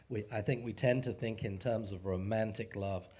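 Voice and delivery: normal talking voice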